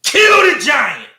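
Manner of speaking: Moses rising to a shout on "Kill the giant"; Moses yelling. Retold or imitated. Moses shouting at the scared people to fight, rising to a yell. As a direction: bark the order like a fed-up Moses. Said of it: "He said".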